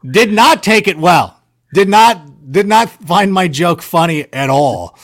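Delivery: slow, with "not" hit hard each time; stress on NOT. Real, not acted. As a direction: lean heavily on "not" in "Did not take it well". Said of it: "repeats "did not" for emphasis".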